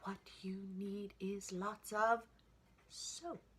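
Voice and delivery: high, slow, whispery mother voice; mommy pig. A narrator's reading. Mommy telling piggy she needs soap, high mock voice. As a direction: coax with a high sing-song. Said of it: "See her mommy".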